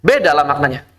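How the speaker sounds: ordinary delivery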